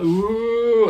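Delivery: high, loud swooping "ooh"; mimed swooping noise. Acted. [name] showing the camera moving mid-shot, high swooping noise. Mimics the camera swinging round.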